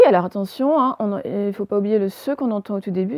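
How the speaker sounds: ordinary delivery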